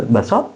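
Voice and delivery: "baso" rising as a question; one-word question on "baso"; clipped question intonation. Shown as a demonstration. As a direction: ask just "baso", lifting the end. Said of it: "Hanya diambil ujungnya".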